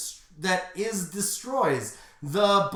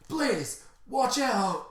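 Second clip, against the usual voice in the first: urgent warning; 6 semitones higher, much slower than usual. Acted. Hero warns friend of blast.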